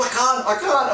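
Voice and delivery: high, strained imitation of panic; mock panic. Telling how he panicked midair, a high strained mock panic. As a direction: squeak out the panic like you can't breathe.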